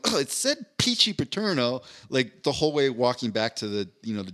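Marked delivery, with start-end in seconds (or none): 0.1-1.8 mocking the lady. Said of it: "he just keeps going".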